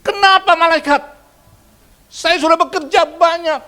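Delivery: very high, loud, slow outraged plea; loud slow protest to the angel; indignant protest. Acted. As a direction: cry out like a wronged man at heaven's gate.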